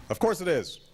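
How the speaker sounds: high-pitched, testy retort as Gramps; insistent grandfather. A narrator's reading. Gramps contradicting his grandson, insistent. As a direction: snap back, pitch jumping up.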